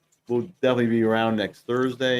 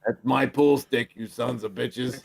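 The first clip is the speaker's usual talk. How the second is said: put-on grumpy old man; slower than usual. Grumpy old guy at pool.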